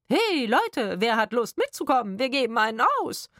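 loud, high put-on party call; mocking corpse voice. A narrator's reading. Woman mimicking the partying dead, high mocking call. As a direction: hoot it out like a ghoul rallying mates.

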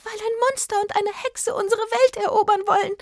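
high, loud fairy squeal; panicked pipsqueak fairy; alarmed little fairy. A narrator's reading. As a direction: cry out the warning in a tiny fairy's high voice. Frightened fairy cries out her warning.

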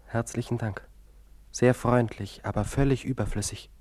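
loud, biting sarcasm; mock the thanks; sarcastic. Patient mocking the doctor, loud sarcasm.

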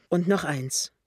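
ordinary delivery

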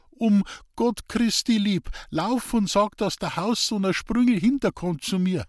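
high, insistent plea; urgent pleading. A narrator's reading. Sick man sends boy for friend, urgent plea. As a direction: beg for a favour urgently.

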